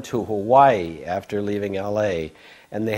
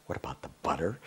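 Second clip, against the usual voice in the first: soft, lower, baffled repeat; puzzled mutter; 7 semitones lower, 11 dB quieter, much faster than usual. Retold or imitated. Speaker repeating the guru's question to himself, quiet and puzzled. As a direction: echo it to yourself, lost. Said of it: "I was kinda going".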